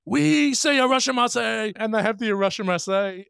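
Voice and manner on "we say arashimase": mock Japanese chef accent. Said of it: "the Japanese chef saying".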